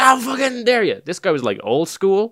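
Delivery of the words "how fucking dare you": high-pitched mock fury; retold outrage. Teacher answers the boy's confession, mock fury. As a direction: imitate an outraged old-school teacher.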